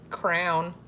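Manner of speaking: "Crown" in one syllable for crayon. Retold or imitated. Imitating Lincoln's word for crayon, said as crown. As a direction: say "Crown" with an OW where crayon belongs. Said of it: "He says it like crown".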